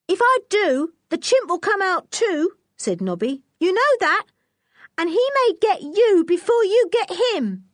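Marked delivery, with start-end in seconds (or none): 0.1-2.6 put-on much higher young boy's voice; a nervous lad pleading his case; scared boy. Nobby warning Lou about the chimp, boyish and high. 3.6-7.6 put-on much higher young boy's voice; a nervous lad pleading his case; scared boy. Nobby warning Lou about the chimp, boyish and high.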